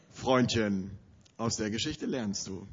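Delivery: quiet threat. Retold or imitated. Inner resolve to teach him.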